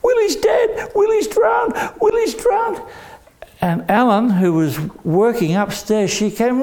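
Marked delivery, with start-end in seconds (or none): 0.0-2.8 high, shrill screams of panic; nanny screaming that the toddler has drowned; panicked scream. Acted. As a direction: scream it out, much higher and frantic. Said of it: "she started to scream".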